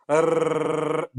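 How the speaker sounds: long trilled Italian R; rolled R. Shown as a demonstration. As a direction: demonstrate a sustained tongue-tip trill. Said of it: "when I said roll the R's".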